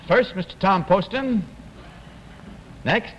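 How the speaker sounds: ordinary talk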